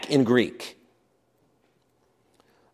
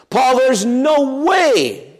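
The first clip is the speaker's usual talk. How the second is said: high, raised cry of protest at Paul; mock objection; 9 semitones higher than usual. Imagined elder protesting to Paul, high and indignant. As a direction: stop him mid-speech in disbelief. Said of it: "waved their hand at Paul and said".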